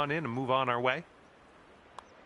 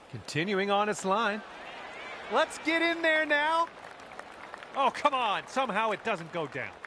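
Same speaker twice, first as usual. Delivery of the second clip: rising excitement, high and urgent; golf commentator; 8 semitones higher than usual. Real, not acted. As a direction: urge the ball toward the cup. Willing a putt into the hole.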